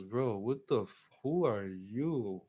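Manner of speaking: slow, drawn-out bewilderment; dazed, dragging question; groggy confusion. Retold or imitated. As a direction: stretch the words like you just woke up. Groggy, just woken.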